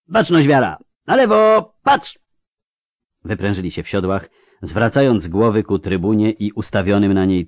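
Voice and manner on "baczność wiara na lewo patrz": loud, raised military order; parade command. A narrator's reading. Commander orders the riders to salute the tribune, shouted command. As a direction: call the troop to attention.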